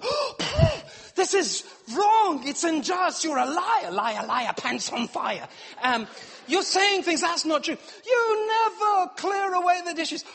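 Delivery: high, loud hammed-up indignation; mock outraged protest. Husband protesting his wife's accusation, high mock outrage. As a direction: protest like a wounded innocent. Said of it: "And I would go".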